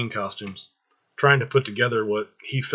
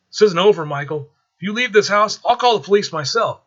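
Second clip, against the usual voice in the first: much higher, louder scolding; loud fake row for the listener on the roof; pretend shouting; 10 semitones higher, 7 dB louder than usual. Mother fakes an angry row so the intruder hears, loud.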